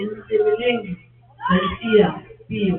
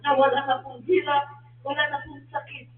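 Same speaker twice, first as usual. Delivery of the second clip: prayer; 7 dB quieter than usual. Real, not acted. Leads a closing prayer.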